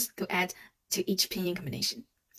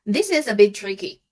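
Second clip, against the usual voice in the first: ordinary talk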